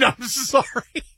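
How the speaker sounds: incredulous echo. Acted. Customer throws banker's apology back, incredulous. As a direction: stretch the apology out in laughing disbelief.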